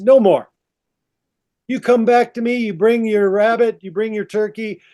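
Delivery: high, emphatic refusal; acted ultimatum. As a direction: play a fed-up woman laying down the law. Eve lays down the law.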